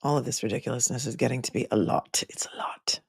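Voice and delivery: soft weary whisper to herself. Real, not acted. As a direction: breathe it out under your breath. Muttering to self on stream.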